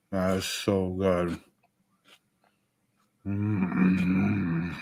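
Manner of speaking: low, savoring, mouth full of food. Real, not acted. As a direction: talk round a bite of stir fry. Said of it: "I'm just gonna eat".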